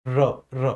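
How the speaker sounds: र र rolled on the tongue; trilled r demo. Shown as a demonstration. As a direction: make the tongue buzz on र, र. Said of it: "जिह्वा में झंझनाहट".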